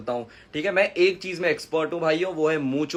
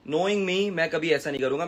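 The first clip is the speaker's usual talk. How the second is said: ordinary talk, nothing marked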